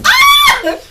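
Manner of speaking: high excited squeal. Real, not acted. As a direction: squeal with delight. Host squeals at guests.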